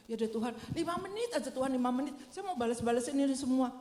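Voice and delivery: hasty bargaining. Retold or imitated. Believer bargaining while texting.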